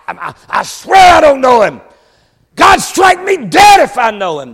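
loud, high shouted oath; stammer, then burst into a vehement acted denial; preacher acting out a desperate sworn shout; cursing denial. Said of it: "He did it with curses".

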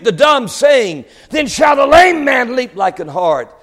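high, driving, emphatic sermon voice; full-force preaching. Real, not acted. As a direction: proclaim it to the congregation with mounting fervour.

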